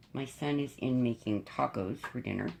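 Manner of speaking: usual speaking voice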